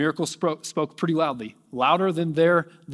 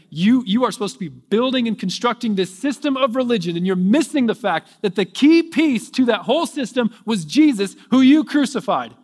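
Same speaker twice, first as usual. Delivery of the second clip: Peter shouting accusations, much louder and higher; accusing shout; 7 semitones higher, 8 dB louder than usual. Acted. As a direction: hurl the blame at the rulers at full voice.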